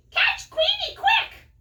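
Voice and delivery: very high, very loud quacking duck; squawking cartoon duck, high and loud; loud duck shout. Dottie calls to animals.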